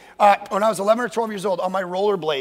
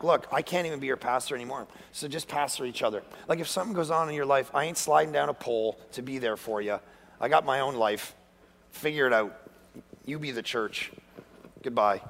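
deadpan dismissal; 7 semitones lower, 7 dB quieter than usual. Retold or imitated. Mock dismissal of congregation.

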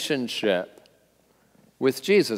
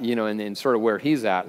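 ordinary delivery

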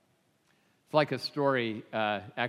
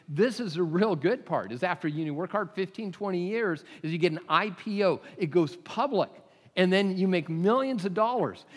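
mock sales pitch, higher and louder; building excitement; 7 semitones higher, 4 dB louder than usual. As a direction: sell the big payoff with rising excitement. Businessman builds to the payoff.

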